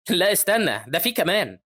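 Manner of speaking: sudden heads-up; urgent warning. A narrator's reading. Sister warns of more bats.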